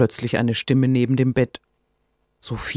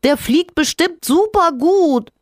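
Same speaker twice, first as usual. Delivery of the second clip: cheeky little creature; 6 dB louder than usual. Acted. Rinus voice.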